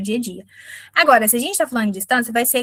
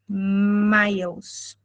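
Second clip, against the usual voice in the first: slow teaching model; 2 semitones lower than usual. Shown as a demonstration. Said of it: "eu vou dizer".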